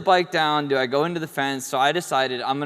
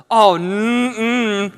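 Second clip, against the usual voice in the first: loud, high hummed refusal; recoiling "mm-mm"; 8 semitones higher, 7 dB louder than usual. Retold or imitated. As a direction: imitate a horrified onlooker backing off. Onlooker recoils at the injury.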